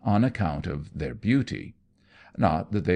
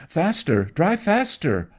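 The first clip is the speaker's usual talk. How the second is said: high, louder, slowed commands; frantic shout; 9 semitones higher, 4 dB louder than usual. Acted. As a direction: yell at the coachman in panic. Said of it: "Anno shouted".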